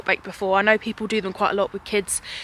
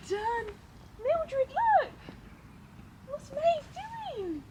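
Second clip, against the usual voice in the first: much higher, soft and far from the mic, praising; 11 semitones higher, 8 dB quieter than usual. Real, not acted. Mum coaching toddler, laughing.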